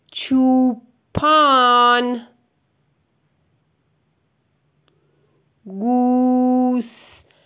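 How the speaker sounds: چوپان گوسفندان را به چراگاه برد stretched out word by word; slow dictation. Shown as a demonstration. Teacher dictating slowly so the child can write each word. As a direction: drag each word out with long gaps.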